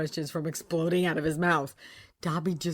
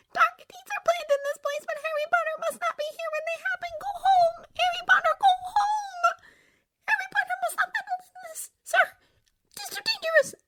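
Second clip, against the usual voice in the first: very high, whispery house-elf squeak; a tiny frantic elf pleading; Dobby voice; 5 dB louder than usual. A narrator's reading. Tearful house elf begging Harry Potter, high and whispery.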